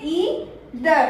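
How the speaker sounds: "either" split as ee, ther; correct pronunciation. Shown as a demonstration. Teacher answering her own question, correct pronunciation. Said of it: "क्या बोलते हैं".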